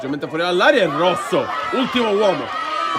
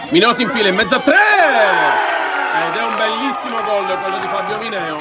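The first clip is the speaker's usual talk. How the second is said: goal shout. Real, not acted. Goal scored, live commentary.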